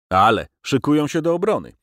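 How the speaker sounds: the officer's son insisting the lads will fight; confident assurance. Acted. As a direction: reassure him with conviction. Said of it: "zapewnił z przekonaniem".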